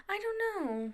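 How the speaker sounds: high, soft, sheepish don't know. Real, not acted. Vlogger owning up to movie love, high and soft.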